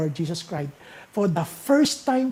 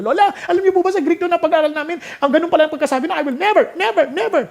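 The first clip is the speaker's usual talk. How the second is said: put-on high, fast, eager child voice, much higher; child talking to grandma; 10 semitones higher, 6 dB louder, faster than usual. Grandchild telling lola about the Greek, high eager child voice. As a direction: squeal it out like an excited grandkid. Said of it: "sabi nung bata sa ating lola".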